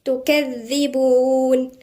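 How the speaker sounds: تكذبون slowly, with a doubled dhal; slow tajweed recitation of تكذبون. Shown as a demonstration. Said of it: "والذال هنا مشددة".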